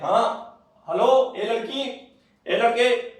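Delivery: high, loud scolding call; teacher shouting. Retold or imitated. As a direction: yell into the exam hall. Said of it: "भाई ऐसे बोलते रहेंगे".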